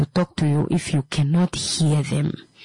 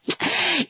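gleeful laugh; 11 semitones higher than usual. Acted. Mock gleeful receiver of news.

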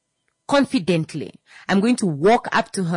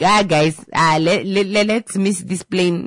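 mock panicked passenger; 3 semitones higher, 6 dB louder than usual. Panicked passenger stammering to others.

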